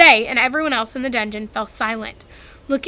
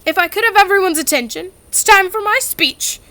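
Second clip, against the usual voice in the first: higher, louder quick announcement; loudly; 7 semitones higher, 6 dB louder than usual. A narrator's reading. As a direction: claim the room's attention. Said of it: "said Nearly Headless Nick loudly".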